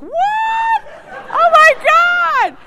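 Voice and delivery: a stunned, laughing cry, slowing on oh my God; shocked shriek. Retold or imitated. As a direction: react as if you can't believe who's calling. Governor calls her unexpectedly.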